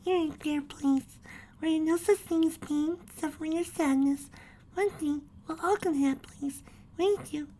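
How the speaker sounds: high, gentle little filly voice; reassuring. Acted. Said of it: "trying to cheer him up".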